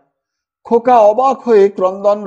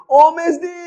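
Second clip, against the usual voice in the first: higher call across the house; 8 semitones higher than usual. A narrator's reading. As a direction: shout for the sister to come. Calling out to sister. (দিদিকে হাঁক)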